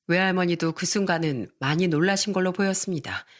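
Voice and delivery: ordinary delivery, nothing marked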